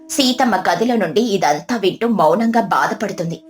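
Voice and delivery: ordinary delivery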